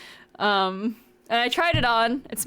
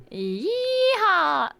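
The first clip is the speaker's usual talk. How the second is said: Southern yeehaw; 9 semitones higher than usual. Acted. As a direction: whoop it like a cowboy. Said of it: "I don't do a good Southern accent".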